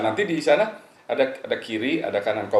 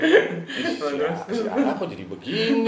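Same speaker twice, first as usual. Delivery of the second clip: laughing disbelief; 11 semitones higher, 3 dB louder than usual. Retold or imitated. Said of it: "Saya bilang".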